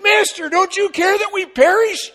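high, loud panicked plea, hammed up; mock panic. Disciples waking Jesus in the storm, in exaggerated alarm. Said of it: "the disciples were panicked".

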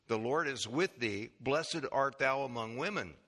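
unhurried, low pulpit reading of scripture. Acted. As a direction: read the passage solemnly, like a Bible reading. Reads Luke 1 scripture aloud.

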